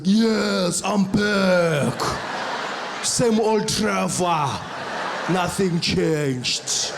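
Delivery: put-on loud, stretched-out South African accent; overdone South African accent. Trevor at customs proving nothing changed, overdone South African accent. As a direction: lay on the South African accent, slow and booming.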